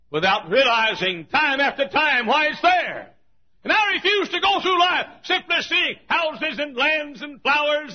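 high, loud and slow, ringing out; fervent revival sermon cry; preaching at full force. Acted. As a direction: preach it to the rafters.

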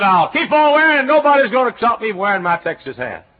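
loud, high, stubborn vow; defiant boast. Retold or imitated. Preacher vowing to keep wearing his Texas hat, loud and defiant. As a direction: insist nobody will stop you.

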